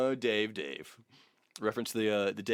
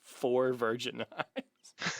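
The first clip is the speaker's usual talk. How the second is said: slow amused repeat; much slower than usual. Real, not acted. Rereading his own phrase.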